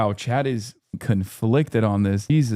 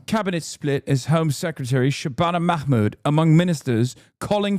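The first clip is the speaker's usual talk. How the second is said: British accent. Shown as a demonstration. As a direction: read it like a British news bulletin. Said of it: "I did the accent for you".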